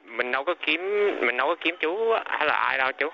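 ordinary talk, nothing marked

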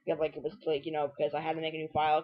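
normal talking voice

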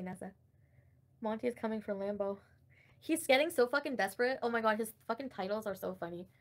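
ordinary talk

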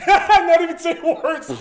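words squeezed out through real laughter; laughing. Real, not acted. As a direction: laugh hard while saying it, slowly. Laughing at the friend's joke.

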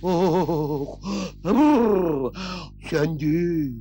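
long, low sigh of relief; low "ahh, that's better" release; relieved sigh. Acted. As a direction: let the pitch drop way down, relieved. Relief once burning is put out.

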